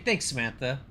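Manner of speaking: normal talking voice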